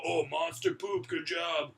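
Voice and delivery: slow, praising dad; lightly voiced proud father; dad cheering a big poop, slowly. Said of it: "he'd go".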